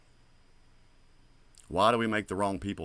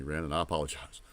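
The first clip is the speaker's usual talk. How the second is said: ordinary talk, nothing marked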